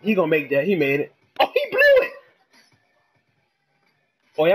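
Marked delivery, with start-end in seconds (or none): 1.4-2.1 high-pitched yelp of disbelief; real, sudden squeal of surprise; shocked outburst. Real, not acted. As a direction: cry out at a blown shot. Reacting to a missed shot.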